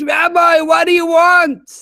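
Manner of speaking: high, loud call down from a window; shouting from the window. Retold or imitated.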